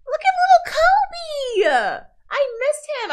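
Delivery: high, loud delighted cooing; excited gushing. Real, not acted. Streamer spotting little Kobe on screen, gushing with delight. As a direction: gush over a favorite character.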